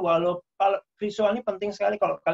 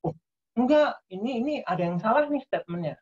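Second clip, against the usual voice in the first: sudden high, fast "oh no, that's wrong"; a student suddenly noticing the lecturer's error; surprised objection; 5 semitones higher than usual. Retold or imitated. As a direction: jump in to catch a mistake, quickly. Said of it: "Langsung kalian".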